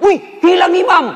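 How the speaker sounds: high, loud startled shout; mock alarmed yell. Boy lifts his head in prayer and yells the imam is gone, mock alarmed yell. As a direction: cry out as if the imam vanished. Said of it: "Langsung teriak".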